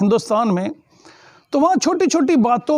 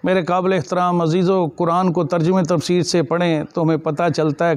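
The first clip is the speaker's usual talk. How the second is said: ordinary talk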